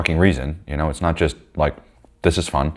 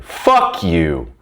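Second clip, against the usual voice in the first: angry. Retold or imitated. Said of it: "that there is anger".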